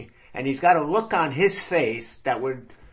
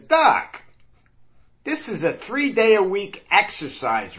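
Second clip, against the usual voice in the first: indignant protest; 6 semitones higher than usual. Acted. Vinny protests the exercise regimen.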